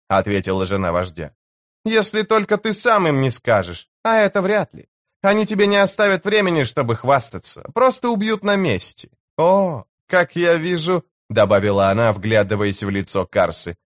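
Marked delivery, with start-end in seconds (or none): 1.8-11.0 high-pitched, cool-headed woman's voice; chief's wife, knowing. Acted. Chief's wife warning the enemy leader, knowing and high-pitched. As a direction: answer him high and knowingly.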